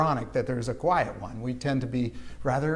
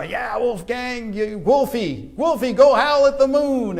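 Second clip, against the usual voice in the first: put-on teasing kid voice, high and rising to loud; mock teasing; 9 semitones higher, 7 dB louder than usual. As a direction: taunt him like a kid on the street. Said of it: "And we'd go".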